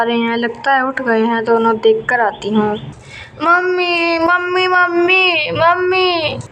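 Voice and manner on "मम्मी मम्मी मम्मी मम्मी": put-on high little girl calling for mummy, tearful; a small child wailing for her mother; crying child calling. Little girl just woken, calling her mother tearfully. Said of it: "क्यों चिल्ला रही हो?".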